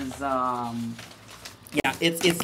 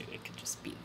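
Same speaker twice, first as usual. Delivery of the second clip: whisper; 23 dB quieter, faster than usual. Real, not acted. Aside after joke name.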